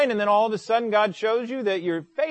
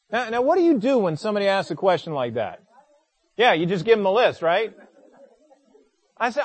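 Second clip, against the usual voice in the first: usual speaking voice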